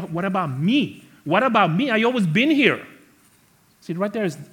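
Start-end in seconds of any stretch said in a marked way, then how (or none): 0.0-2.9 high pleading what about me; whiny plea. Retold or imitated. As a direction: beg like a jealous son. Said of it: "He's saying".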